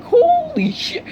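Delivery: very high, squeaky shock; stunned exclamation. Acted. Onlookers watching the hero's rage, stunned. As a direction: squeak it out in awe.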